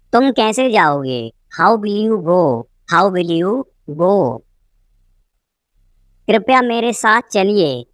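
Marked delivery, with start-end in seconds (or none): 2.9-4.1 teacher separating off the word "go"; broken-up repeat. Shown as a demonstration. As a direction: pause before "go".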